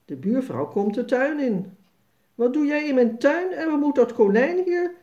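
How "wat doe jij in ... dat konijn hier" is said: raised, louder scolding; angry neighbour scolding a boy in her garden. A narrator's reading. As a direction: tell the boy off, cross and loud. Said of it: "zegt de buurvrouw boos".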